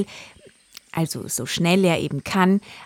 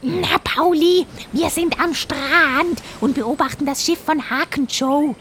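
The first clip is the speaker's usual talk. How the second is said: jaguar voice; 9 semitones higher, 4 dB louder than usual. Acted. Jaguar character voice.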